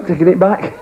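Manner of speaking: ordinary delivery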